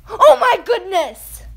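slow, loud, high exclamation; real overjoyed disbelief, drawn out; stunned excitement. Real, not acted. Gamer whose dream trade just went through, stunned excitement.

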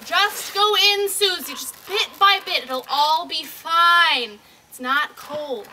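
loud, slow coaxing call across water. Acted. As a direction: shout encouragement to a child far off. Calling to child in water.

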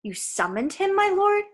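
anxious servant, voice jumping up on the last words; tense. A narrator's reading. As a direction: question leaping high at the end. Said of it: "servant suddenly sounded tense".

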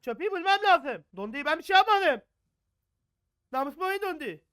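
high, loud panicked shouting; panicked yell. Real, not acted. Panique face au jeu d'horreur.

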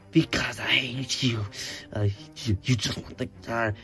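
low menacing villain. Acted. Villain answers coldly.

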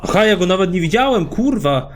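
high, loud burst of surprise; startled outburst. Real, not acted. Player caught by an unseen pursuer, startled outburst. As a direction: blurt it out, caught off guard.

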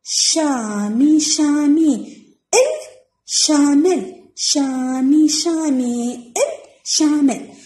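شامل drawn out slowly as شا، مي، ل; syllable by syllable. Shown as a demonstration. Teacher breaking a lesson word into syllables for pupils, syllable by syllable. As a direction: sound out شامل in long, separate syllables. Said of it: "راح نقطع كلمات الدرس".

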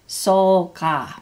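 "sol" strong, "ka" lighter; stress on SOL. Shown as a demonstration. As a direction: punch SOL in "sol ka". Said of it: "keep the stress on the sol".

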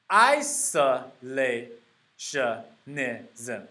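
"isolationism" very slowly, a pause after each syllable. Shown as a demonstration. Teacher sounding out a hard new noun, "isolationism" syllable by syllable.

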